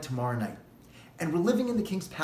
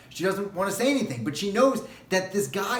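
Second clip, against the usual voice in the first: usual speaking voice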